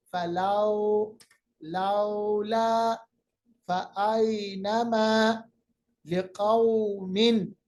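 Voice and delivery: फलौला and लिकौमिन slow, vowels soft; soft drawn vowel. Shown as a demonstration. Teacher drills words slowly for class. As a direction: draw out each au gently. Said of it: "कौम नहीं".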